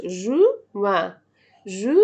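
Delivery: "جوان" slow, ending in a vowel without N; silent N. Shown as a demonstration. Teacher showing the silent N, "جوان" slow with no N. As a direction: stretch "جوان" as "zhu-an", N left unsounded. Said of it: "ال إن ما بتنطقش".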